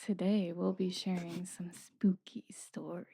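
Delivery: hushed creepy whisper; spooky voice. Pretend. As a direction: whisper it like a ghost story. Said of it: "this spooky voice on".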